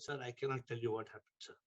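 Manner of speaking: low, hushed reply, retold; reluctant fisherman. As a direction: act out a man too troubled to tell.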